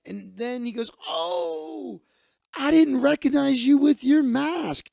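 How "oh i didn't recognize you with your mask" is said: high, loud, drawn-out "Oh" of recognition; delighted recognition. Retold or imitated. Man realizes he knows the waving woman, excited greeting. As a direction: imitate greeting someone you suddenly recognize.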